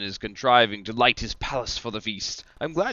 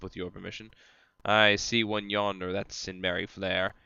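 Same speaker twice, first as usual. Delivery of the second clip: ordinary talk, nothing marked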